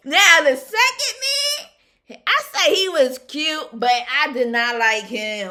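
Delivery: laughing through the words, loud and high. Real, not acted. Laughing at the second date she disliked, real laughter. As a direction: crack up while telling it.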